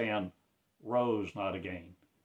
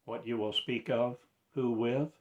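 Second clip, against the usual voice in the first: normal talking voice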